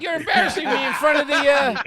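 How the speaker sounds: fast, loud, very high pleading; squeaky wannabe losing face; embarrassed protest. Acted. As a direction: blurt it out high and flustered. Mo pleads, repeated over laughter.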